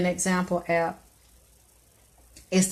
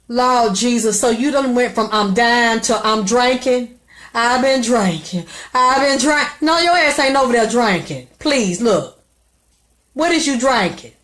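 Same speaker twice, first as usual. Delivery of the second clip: loud, high-pitched disbelief; loud, high scolding of a drinking caller; incredulous outburst; 4 semitones higher, 6 dB louder than usual. Real, not acted. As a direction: call her out, can't believe it.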